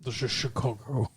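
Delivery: low, hushed Scottish slur; quiet, low Connery-style burr; Sean Connery impersonation. Acted. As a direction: play Connery with slushy S sounds, softly. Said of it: "do Sean Connery impersonations".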